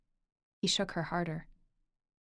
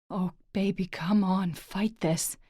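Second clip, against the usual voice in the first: loud, urgent pleading; desperate plea; 5 dB louder, slower than usual. Acted. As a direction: urge her to fight it. Lorcan pleading with Orla.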